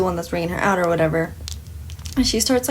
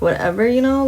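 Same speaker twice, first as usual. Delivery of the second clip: ordinary talk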